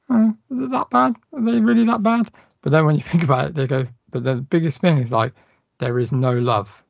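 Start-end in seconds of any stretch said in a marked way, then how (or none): 0.1-2.3 high, wide-eyed mock wonder; squeaky disbelieving question. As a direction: ask it like a naive convert.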